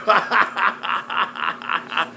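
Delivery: high staccato cackle; long run of ha-ha-has; derisive laugh. Real, not acted. Laughs at the man being named.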